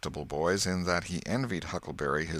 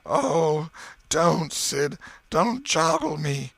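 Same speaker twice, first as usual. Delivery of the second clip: high, moaned pleading; hammed-up moaning plea; 10 semitones higher, 5 dB louder, much slower than usual. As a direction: moan as if in pain. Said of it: "Tom moaned out".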